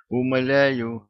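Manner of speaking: ordinary delivery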